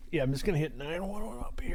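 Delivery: hushed, voiceless whisper. Real, not acted. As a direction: whisper it so nobody hears. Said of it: "calling 911 quietly".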